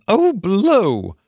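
high, exasperated outburst; fed-up little mole cries out. A narrator's reading.

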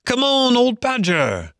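high, long-carrying hail to a friend; calling shout. A narrator's reading. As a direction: call out high, falling at the end. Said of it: "shouted the rat".

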